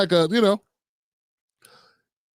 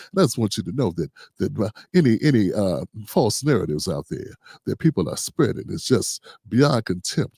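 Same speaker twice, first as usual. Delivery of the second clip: much lower, smooth soothing preacher voice; talking all smooth; 10 semitones lower than usual. Retold or imitated. Commentator mocking the pastor's smooth apology, low and silky. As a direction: purr it like a slick apologetic preacher. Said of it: "But listen to him now".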